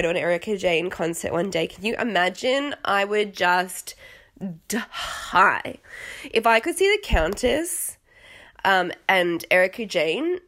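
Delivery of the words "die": usual speaking voice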